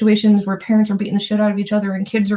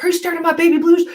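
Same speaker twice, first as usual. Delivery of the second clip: higher, fast drill sergeant jab; 8 semitones higher, faster than usual. Retold or imitated. Drill sergeant voiced, yelling.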